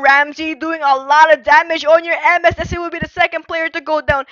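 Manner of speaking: fast, loud, high excited casting; live play-by-play. Acted. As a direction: call the teamfight at full speed.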